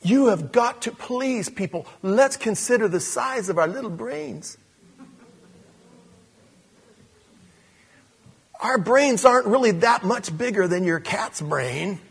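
high, loud, impassioned preaching; preaching at full force. Acted. Pastor urging the congregation, preaching at full force. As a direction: lift the congregation with rising fervor.

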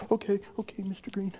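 put-on a timid six-year-old answering his teacher; nervous little boy. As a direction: quietly play the shy little kid.

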